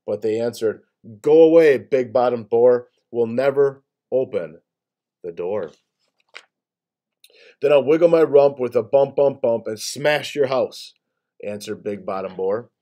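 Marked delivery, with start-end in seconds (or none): 1.2-5.7 defiant shout. Acted. Gators shout back at boar. 7.6-10.9 boar voice. Acted. Boar threatens the gators.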